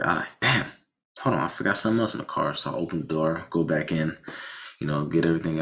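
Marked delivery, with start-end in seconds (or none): 0.4-2.5 fast, quick-fire remembering; sudden recall. Retold or imitated. Driver remembering items in the car, fast sudden recall. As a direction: rush it out as if just remembering.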